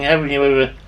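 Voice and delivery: ordinary delivery, nothing marked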